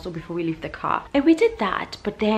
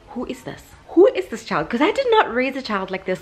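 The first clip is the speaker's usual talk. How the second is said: exasperated; 6 semitones higher than usual. Real, not acted. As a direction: throw up her hands in outrage. Exasperated mom disowning behaviour.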